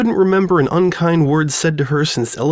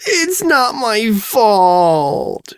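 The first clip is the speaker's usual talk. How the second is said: high, slow drawn-out wail; 10 semitones higher, much slower than usual. Acted. Distraught girl wails her innocence to Sudsy. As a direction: wail it out through tears. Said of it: "she wailed".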